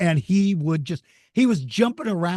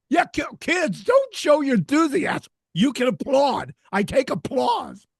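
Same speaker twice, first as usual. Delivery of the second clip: mock scolding the kids; 11 semitones higher than usual. Speaker mock-addressing the schoolkids, hammed-up warning. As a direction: pretend to order the kids to hold back.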